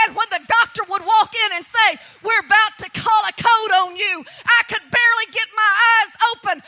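loud, ringing preacher's voice; full force preaching. Acted. Testifying to the congregation about her faith, preaching loud. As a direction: preach it out to the back row.

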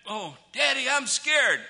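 high, mock-scared kid's plea; imitating frightened child. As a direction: play a scared child calling for Dad. Voices frightened children in a story.

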